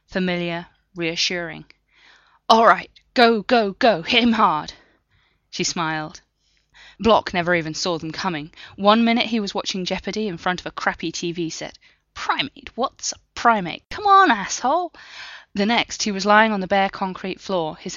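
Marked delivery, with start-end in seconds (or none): 2.5-4.7 loud, urgent command to move in; shouted order. Acted. Team leader ordering the raid, loud and urgent. 12.1-14.9 high, loud frustrated shout at a quiz show; yelling at the TV. Acted. Block yelling at Jeopardy on TV, loud and frustrated.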